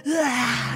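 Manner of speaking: a high, brief mock wail of the damned; imitated wail. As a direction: voice the damned souls wailing, lightly. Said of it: "screaming and crying out and wailing".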